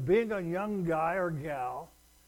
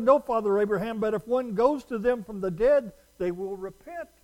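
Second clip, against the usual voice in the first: rich man pleading; 5 semitones higher, 8 dB louder than usual. Acted. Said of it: "And he said".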